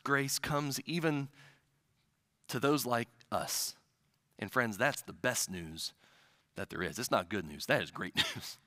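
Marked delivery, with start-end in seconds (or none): none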